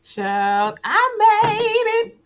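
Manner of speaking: high, slow gospel-singer wail. Retold or imitated. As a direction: stretch it out like a church soloist testifying. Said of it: "All right, [name]".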